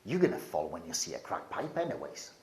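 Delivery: low, soft, sinister taunting voice; low menacing whisper of temptation; foul voice. Retold or imitated. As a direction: hiss it like a mocking demon. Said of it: "this foul voice saying".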